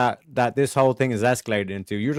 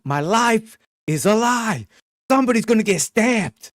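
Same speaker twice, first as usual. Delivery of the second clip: Frank Reynolds; 7 semitones higher than usual. Retold or imitated. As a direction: play the deranged dad losing it. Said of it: "like the whole Frank Reynolds".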